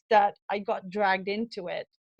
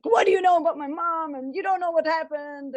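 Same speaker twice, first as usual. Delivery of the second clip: mock-indignant child snapping back about his mom; defensive kid; 7 semitones higher than usual. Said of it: "the kid reacts very defensive".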